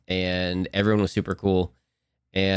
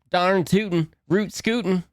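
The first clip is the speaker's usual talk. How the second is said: higher mock Texan twang on "Darn tootin'"; "Darn tootin'" pitched up in a hammed-up Texan voice; Texan accent; 10 semitones higher than usual. Playing her Texan impression again, light Texan accent. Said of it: "Those are my two".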